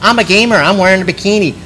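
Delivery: high, sing-song mock gamer-girl voice; sarcastic gamer girl. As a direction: mimic a sarcastic co-host mocking fake gamer girls. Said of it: "being a little bit sarcastic".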